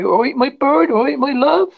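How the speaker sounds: high-pitched mock Devon accent; Devon locals greeting. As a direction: imitate a chummy Devon local. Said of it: "people used to come and say".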